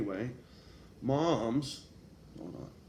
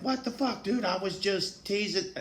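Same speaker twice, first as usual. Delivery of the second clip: put-on much higher, indignant kid voice; stunned kid; 8 semitones higher than usual. Slapped classmate protesting to the narrator, indignant and high. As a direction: play the slapped kid who can't believe it. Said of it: "And he's like".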